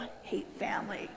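whispered grumble, barely audible; bitter whisper. Retold or imitated. Eyeing the happy church families, whispered disgust. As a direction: mutter it under your breath in disgust.

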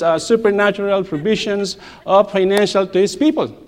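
normal talking voice

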